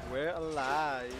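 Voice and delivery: slow, stretched-out words at normal volume; long, settling exhale of relief; drawn-out relief. Real, not acted. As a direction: let each word sink in, slowly.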